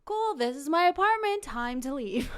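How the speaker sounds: loud, high false brightness turning to dismissal; acted brisk send-off; pointed dismissal. Woman hurrying uninvited date out, loud pointed dismissal.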